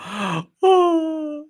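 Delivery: high theatrical gasp; mock gasp. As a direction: gasp in hammed-up shock. Mocking agent hearing Trump won.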